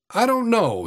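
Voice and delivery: mildly bitter, pitched well up. A narrator's reading. Tramp answering the boy about his hopes, bitter. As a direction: let some bitterness lift the words. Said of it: "he said bitterly".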